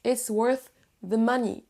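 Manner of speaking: slow WORTH THE, the two TH sounds linked; slow careful join from WORTH into THE MONEY; measured model linking WORTH to THE. Shown as a demonstration. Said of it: "la liaison avec la suite".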